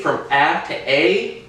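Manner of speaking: first "a" short as in rational, second "a" long as in vibrate; contrasting A sounds. Shown as a demonstration. Showing how the vowel changes, short "a" then long "a". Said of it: "the pronunciation of the A".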